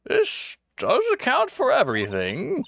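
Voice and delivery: female character voice. Acted. Voicing game witness.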